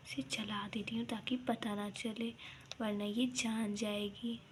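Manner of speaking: plotting in a whisper; sneaky saas whispers. Acted. Secret plotting at night.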